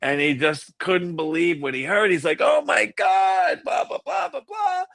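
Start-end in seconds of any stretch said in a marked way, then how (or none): 2.4-4.8 be blown away; mock stunned exclamation; amazed. Amazed reaction quoted.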